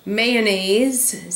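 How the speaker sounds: usual speaking voice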